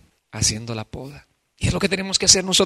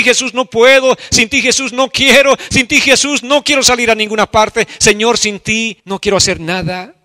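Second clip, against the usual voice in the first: high, loud impassioned preacher's cry; a pastor crying out a prayer of repentance before the church; fervent preaching; 7 semitones higher, 11 dB louder than usual. Acted. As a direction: pour out the prayer at full force, higher and louder. Preaching at full force.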